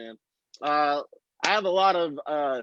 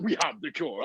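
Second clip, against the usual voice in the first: lower, softer mock Nigerian accent; 3 semitones lower, 5 dB quieter than usual. Comic comparing believable accents, imitated Nigerian accent. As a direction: imitate the Nigerian doctor, low and soft. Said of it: "trust that accent with that message".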